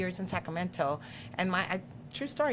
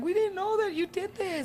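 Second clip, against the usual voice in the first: put-on moved kids; 11 semitones higher than usual. Kids crying over her bio.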